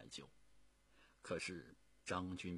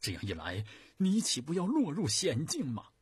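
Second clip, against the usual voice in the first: anxious question rising much higher and louder; deeply worried; 8 semitones higher, 10 dB louder than usual. A narrator's reading. Zhu asking his guard about the danger, worried and anxious. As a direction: plead in alarm for him. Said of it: "非常担心地望着吴良说".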